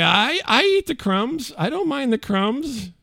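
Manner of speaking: high, slow placating; meek family. Retold or imitated. Son and father placating wife, meek. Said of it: "we're like".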